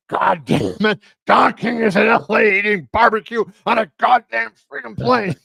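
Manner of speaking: mock the promoter losing it at the podium, half shouting; furious outburst. Promoter on the dais raging about Don King eating barbecue, mock-furious shout. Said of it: "Arum goes up to the, uh, dais".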